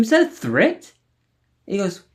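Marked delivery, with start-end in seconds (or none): 0.0-0.9 high, loud indignant challenge; challenging. Retold or imitated. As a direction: throw it back at him, pitched up and louder. Confronting the cop, retold.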